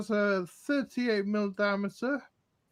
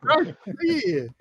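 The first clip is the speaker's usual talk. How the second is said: higher, louder Aussie greeting; broad, hearty Australian accent; 4 semitones higher, 5 dB louder than usual. Shown as a demonstration. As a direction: ham up the Australian hello on request. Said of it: "Can you do your Australian accent?".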